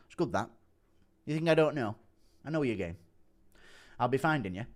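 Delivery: terse, quiet mimicked menace; understated threat in the fighter's voice; Andy Aspinall impression. Fighter answering scam caller, softly impersonated. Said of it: "Impression of Andy Aspinall getting a scam call".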